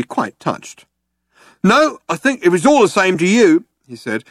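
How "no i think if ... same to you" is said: high, loud guard voice; dutiful jobsworth guard; Vogon guard. A narrator's reading. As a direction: refuse politely in a big guard's voice.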